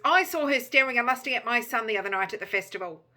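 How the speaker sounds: loud, indignant accuser; crowd accusation. A narrator's reading. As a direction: call out the accusation over a crowd.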